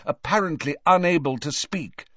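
plain reading voice

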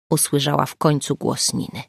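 usual speaking voice

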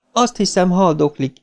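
high, sniffly dismay; sniffling. A narrator's reading. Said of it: "szipogta Beverly".

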